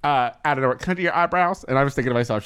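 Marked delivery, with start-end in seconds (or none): 0.5-1.6 fast, offhand imitation; rushed quoting. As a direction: rattle it off like a friend. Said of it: "Shay was like".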